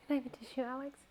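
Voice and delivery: soft, choked request; crying, calling for help; tearful. Real, not acted. Crying, asking for tissue.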